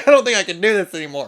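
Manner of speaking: rushed, hammed-up distress; playfully at the end of your rope; mock overwhelm. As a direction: blurt it out fast in mock panic. Acted overwhelm after flashback.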